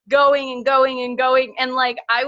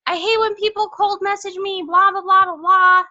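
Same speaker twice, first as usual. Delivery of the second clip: much higher and louder, whiny mockery; mocking whiny poster; 10 semitones higher, 5 dB louder than usual. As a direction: parody people complaining online. Mocking people's Facebook posts.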